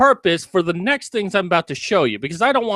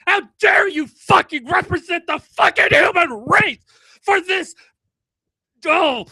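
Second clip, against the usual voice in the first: furious shouting; 4 semitones higher than usual. Real, not acted. Ranting at the author of the piece, furious shouting. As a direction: scream it out, full of rage, hitting every curse.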